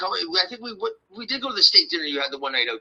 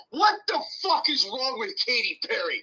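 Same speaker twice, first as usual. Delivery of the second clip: ranting in disbelief to the audience; exasperated rant; 8 semitones higher than usual. Real, not acted. Exasperated rant after segue.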